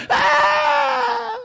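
put-on very high, loud child's scream; piercing mock-child yell; little girl's shriek. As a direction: shriek like a startled little girl. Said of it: "escutou o berro da filha".

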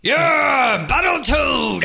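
high hype cheer; cheering shout. Acted. A game master hyping the Battletoad like a fan, shouting. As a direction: yell it like a ringside fan.

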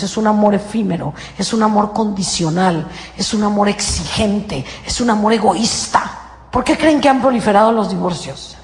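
ordinary talk, nothing marked

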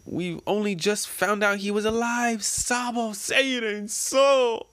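high, drawn-out mock crying; tearful wail. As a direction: wail it slowly through tears. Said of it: "crying their eyes out".

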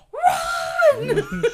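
high, loud cry to flee; panicked Harry yell. Acted. Harry to his friends after the armor crash, panicked yell. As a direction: yell it like an urgent alarm. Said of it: "to which then Harry's like".

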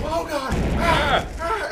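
high, loud panicked screams; panicked screaming. Retold or imitated. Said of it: "Stop yelling!".

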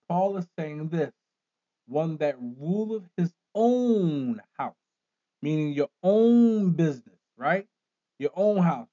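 loud, slow, rising sermon; fiery preaching. Acted. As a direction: preach it at full force. Preaching climax.

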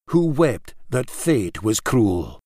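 usual speaking voice

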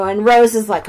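ordinary talk, nothing marked

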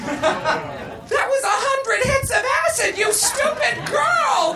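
very high, drawn-out screech; furious bellowing. A narrator's reading. Missus Garrett scolds Tootie for eating acid, bellowing. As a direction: screech the scolding slowly, word by word. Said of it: "bellowed Missus Garrett".

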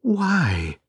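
a mild gasp of recognition; gently surprised exclamation; surprised wife. Acted. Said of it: "said my wife".

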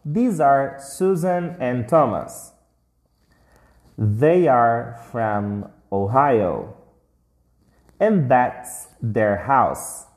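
slow, careful model sentences; teacher modelling for students, slow and clear; slow read-along. Shown as a demonstration. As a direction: read it out slowly for the class to copy. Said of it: "Mais uma vez, repete comigo, em voz alta".

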